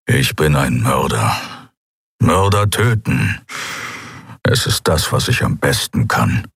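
a hint of a brooding killer; grumbling killer, resigned.

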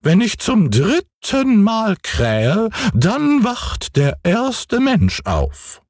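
hoch und sehr langsam gerufen; goldener Hahn. Acted. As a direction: stolz herabrufen wie ein Turmhahn. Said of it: "rief der goldene Hahn herunter". (hohe, gedehnte Hahnenstimme)

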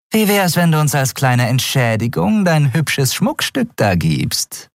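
lightly voiced cheeky thug; sly rogue Grau. Grau bargains for the bracer.

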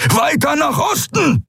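very high, slow-stretched shout; fierce shouted command; barked order. A narrator's reading. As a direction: bark it imperiously at the pilot. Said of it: "herrschte er den Piloten an".

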